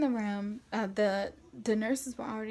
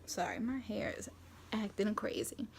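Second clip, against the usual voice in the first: ordinary delivery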